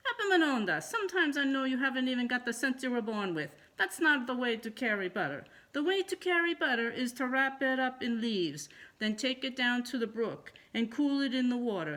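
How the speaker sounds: a mother lecturing her silly son; scolding mother. A narrator's reading.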